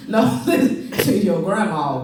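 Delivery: normal talking voice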